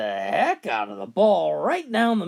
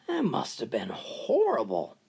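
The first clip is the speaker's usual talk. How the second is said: breathy shocked whisper; 4 semitones lower, 4 dB quieter than usual. Acted. Golfer whispers his sympathy to grieving Bob, appalled. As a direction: hush it out, appalled. Golfer reacts to the wife's death.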